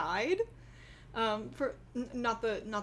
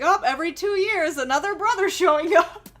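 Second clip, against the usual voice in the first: mock exasperated; 5 semitones higher, 10 dB louder than usual. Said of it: "it was like".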